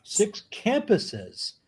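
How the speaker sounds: high, slow disbelief on "Six campuses"; mock amazement; incredulous repeat. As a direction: stretch it out in disbelief.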